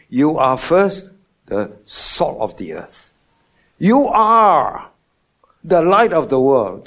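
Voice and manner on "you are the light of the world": emphatic proclamation. Real, not acted. Preacher proclaims identity.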